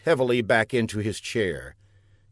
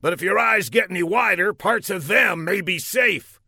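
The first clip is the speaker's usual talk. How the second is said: high, fast blustering general; 8 semitones higher than usual. Acted. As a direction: play the pompous general. General character voice in story.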